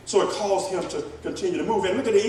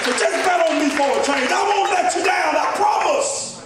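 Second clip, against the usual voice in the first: pleading shout; 8 semitones higher than usual. Acted. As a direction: beg them to believe you, full voice. Preacher voicing someone begging.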